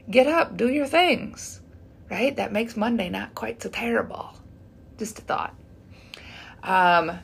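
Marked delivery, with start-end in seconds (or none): 2.4-5.5 soft, breathy half-whisper; whispered aside. Real, not acted. Whispering a tip to viewers, softly. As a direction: let it slip out like a secret.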